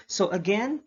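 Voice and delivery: usual speaking voice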